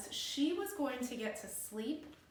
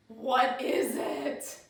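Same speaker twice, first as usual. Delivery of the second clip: slow, emphatic question; 6 dB louder, much slower than usual. Acted. Grandma's repeated question, now slowed.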